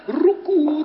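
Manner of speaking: light playful dove coo; imitating a dove. Shown as a demonstration. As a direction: coo it out for the children. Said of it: "zo klinkt".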